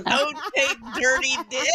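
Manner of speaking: high, loud laughing repeat. Real, not acted. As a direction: laugh the words out in disbelief. Repeats funny chat comment laughing.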